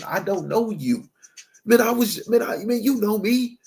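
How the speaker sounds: Lord at the peephole. Pretend. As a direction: voice the Lord refusing through the door. The Lord voiced behind peephole.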